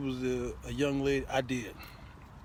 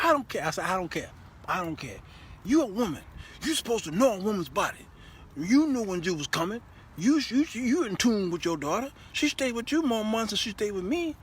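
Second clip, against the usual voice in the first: louder, heated rant; going off on her; 7 semitones higher, 4 dB louder, faster than usual. Retold or imitated. Husband goes off on his wife about women's bodies, louder rant. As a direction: rant at her in mock anger. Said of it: "I started going off on her".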